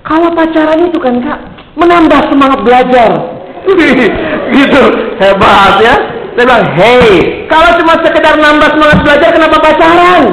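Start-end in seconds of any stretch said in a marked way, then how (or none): 0.1-3.1 lightly voiced schoolboy, higher pitch; a hint of a schoolboy, pitched up; junior-high kid. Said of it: "ini anak S M P nih". 6.7-10.2 high-pitched retold rebuke; acted exasperated retort; mock scolding.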